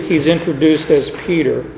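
usual speaking voice

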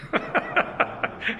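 high, light chuckle; soft, high-pitched real laugh. Real, not acted. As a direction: chuckle at your own joke. Laughs at his own joke.

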